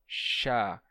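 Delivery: chá with a clear hissing sh; demo sound chá. Shown as a demonstration. Teacher showing students the sh hiss. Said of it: "produzir esse chiado".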